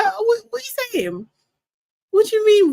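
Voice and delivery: high incredulous what; can't believe what she's reading; disbelief. Real, not acted. Host reacting to a viewer claim, incredulous.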